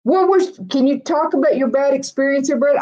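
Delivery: high-pitched, incredulous what; shocked disbelief. Real, not acted. Streamer questioning a viewer's bad Waffle House experience, shocked. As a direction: react as if you can't believe it.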